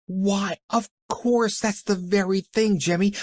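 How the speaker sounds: put-on high, eager girl's delight; bright with a sudden idea; excited.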